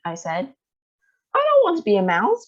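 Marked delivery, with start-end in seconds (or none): 1.3-2.5 high, loud tantrum shout; a boy jumping up and down, shouting high and loud; angry shout. Acted. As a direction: yell it like a stamping child. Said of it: "Bruno shouted".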